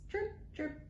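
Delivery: ordinary delivery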